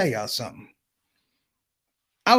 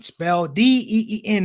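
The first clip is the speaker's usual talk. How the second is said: ordinary talk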